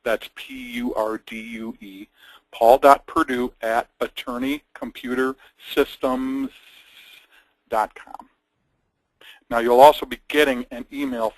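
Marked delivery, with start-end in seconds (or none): 0.3-2.1 spelling out letters. Shown as a demonstration. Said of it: "for those of you who aren't familiar". 2.5-8.3 slow, deliberate dictation; slow, spelled out. Shown as a demonstration. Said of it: "for those of you who aren't familiar".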